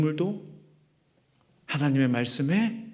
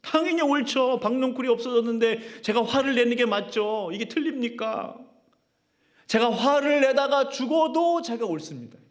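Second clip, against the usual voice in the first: high, insistent, defiant protest; stubborn Jonah; 8 semitones higher than usual. Retold or imitated. Jonah defiantly answering God, high stubborn insistence. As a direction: argue back to God as if you are sure. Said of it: "무슨 말이에요?".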